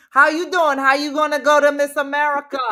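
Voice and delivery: put-on loud, high New Jersey accent; East Coast accent. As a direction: belt it out as brash New Jersey. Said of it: "But you cannot go on".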